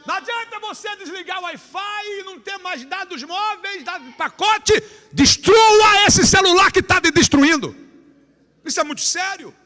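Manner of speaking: high, loud preaching appeal; preaching at full force. Acted. Preacher urging the youth at the camp, loud high appeal. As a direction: press the youth hard, almost shouting.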